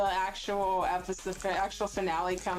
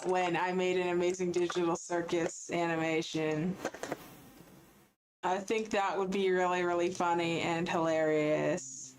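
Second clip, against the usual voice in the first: normal talking voice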